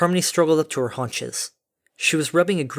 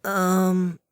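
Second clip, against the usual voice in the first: higher, drawn-out hesitant um; nervous; 6 semitones higher than usual. A narrator's reading. Dinky shrinks into the mailbag, nervous. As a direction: let it out uneasily, higher. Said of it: "she shrunk nervously".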